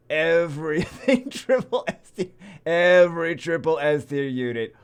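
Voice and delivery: loud laughing disbelief; amazed, laughing as you list; laughing amazement. Real, not acted. Marvels at rank one account.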